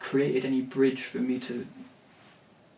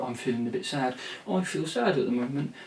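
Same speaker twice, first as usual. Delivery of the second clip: light West Country lilt on the lyric; mock lyric in a mild West Country accent. Vlogger slipping into a mild West Country accent. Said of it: "West Country accent".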